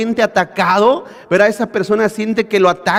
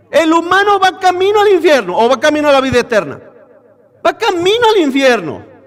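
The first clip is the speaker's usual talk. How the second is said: fiery preaching; 6 semitones higher than usual. Acted. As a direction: preach it out with conviction. Preaching at full force. (predicación con fuerza, voz alta)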